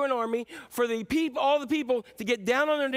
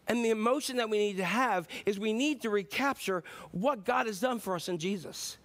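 ordinary talk, nothing marked

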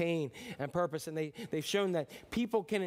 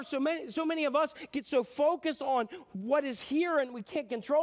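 ordinary delivery

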